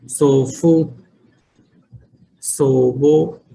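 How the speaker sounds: sofu, sobo said slowly, each vowel drawn out; teacher sounding out सोबो slowly for the class; drawn-out model word; model pronunciation. Shown as a demonstration. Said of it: "दादा दादी को क्या बोलते हैं?".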